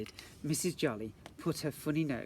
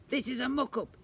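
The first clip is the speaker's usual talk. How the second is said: high, loud bungling robber; silly robber; 10 semitones higher, 5 dB louder than usual. Acted. Robber bungles his hold-up, high and loud. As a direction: play the hapless robber.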